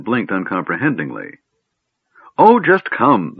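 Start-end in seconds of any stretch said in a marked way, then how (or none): 2.4-3.4 high, loud, drawn-out exasperation; impatient. A narrator's reading. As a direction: give up explaining and order them along. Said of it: "the gnome said impatiently".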